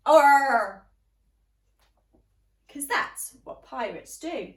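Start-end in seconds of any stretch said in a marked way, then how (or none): 0.1-0.9 big loud pirate Arr; pirate shout. Shown as a demonstration. Teacher showing children how to shout like a pirate. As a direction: roar it out like a captain. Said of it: "Can you try?".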